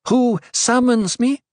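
high, loud summons; commanding oracle. A narrator's reading. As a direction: demand it from on high. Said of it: "Her amplified voice boomed".

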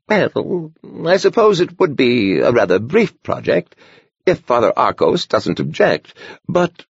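put-on a startled old monk hedging; hesitant old man. A narrator's reading. Horner wavers in doubt.

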